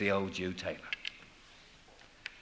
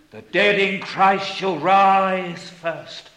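loud, high, ringing proclamation; slow, drawn-out pulpit cry, much louder; full-force preaching; 8 semitones higher, 9 dB louder, slower than usual. Real, not acted. Preacher urging hearers to behold the Lamb, proclaiming loudly.

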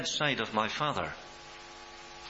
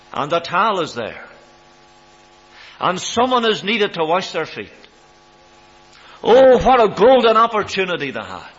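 loud, high preacher's cry; preaching at full force; 7 semitones higher, 11 dB louder than usual. Acted. Preacher to the congregation, loud and ringing. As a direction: rise to a ringing pulpit climax.

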